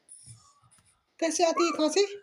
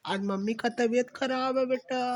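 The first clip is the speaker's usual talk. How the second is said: normal talking voice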